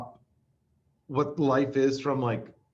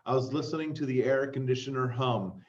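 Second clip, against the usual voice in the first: ordinary talk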